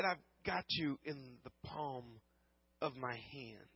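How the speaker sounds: soft, slow promise from God; hushed promise. Retold or imitated. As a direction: let it drop to a near whisper, tender and slow. God's promise, repeated hushed.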